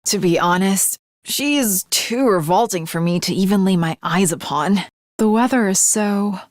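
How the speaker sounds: a hint of a disdainful noblewoman; lightly voiced haughty lady. Second character, lower voice.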